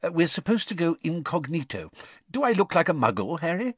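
mildly anxious, hesitant start. A narrator's reading. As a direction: seek reassurance, a touch worried. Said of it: "he asked anxiously".

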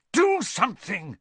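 urgent, pleading request; desperate plea. A narrator's reading. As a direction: beg for help. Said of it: "he said desperately".

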